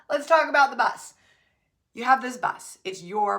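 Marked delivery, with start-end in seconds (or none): 0.1-1.1 louder, laughing through it; laughing restart. Real, not acted. Speaker restarting her bus point with a laugh.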